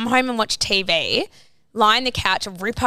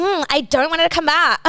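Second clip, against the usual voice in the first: higher-pitched little kid's refusal, lightly mimicked; imitated bratty child; 7 semitones higher, 3 dB louder than usual. Little girl rejecting babysitter in front of mum, imitated brat. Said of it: "eight saying it like this".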